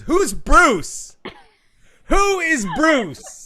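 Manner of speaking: high, loud, drawn-out bewildered demand; mock indignant shout. Quoting Tom at the picnic, a loud baffled shout. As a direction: bellow the name like an outraged guest. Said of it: "Tom Carosa says".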